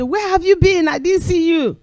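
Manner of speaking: high, friendly question; imitating a friend. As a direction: ask after a missed friend. Someone asks after her.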